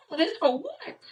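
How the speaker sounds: high, laughing, incredulous squeak; laughing disbelief. Real, not acted. Retelling uncle's reversal, high laughing disbelief.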